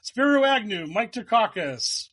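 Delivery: high, slow fake Greek; fake foreign language. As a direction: rattle off names as mock Greek. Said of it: "you pretend to speak Greek by just".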